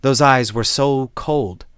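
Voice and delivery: ordinary delivery, nothing marked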